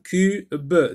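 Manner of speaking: "cube" cut in two, the final b sounded separately; split into syllables. Shown as a demonstration. Teacher sectioning the word for learners, "cube" in two beats. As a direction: pause inside "cube" before a separate "be". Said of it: "on sectionne le mot en syllabes".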